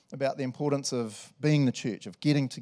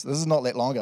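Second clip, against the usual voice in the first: ordinary delivery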